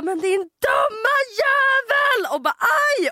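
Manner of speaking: high, slow furious yell; boyfriend cursing the pigeon. Retold or imitated. Boyfriend cursing at the pigeon, high furious yell. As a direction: bellow the curse like he's being attacked. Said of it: "jag hör hur Jakob bara".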